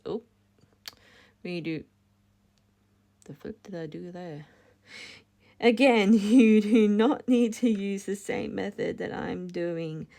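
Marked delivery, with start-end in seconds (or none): 5.6-10.1 laughing through a restarted line, louder; louder laughing retake after a slip. Real, not acted. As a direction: giggle while repeating the instruction.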